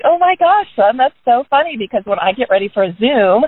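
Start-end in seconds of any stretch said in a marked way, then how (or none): 0.1-1.8 delighted exclaim. Retold or imitated. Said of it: "And I was like".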